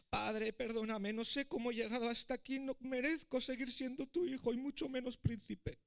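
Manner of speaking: high-pitched, distressed confession; tearful plea. A narrator's reading. Weeping prince begging his father's forgiveness, anguished. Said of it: "El príncipe rompió a llorar".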